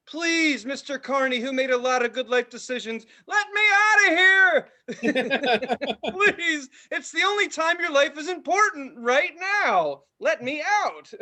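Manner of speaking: high, pleading mock beg to the carny; hammed-up desperate plea; mock begging. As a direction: beg the ride operator to let you out. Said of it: "begging the guy".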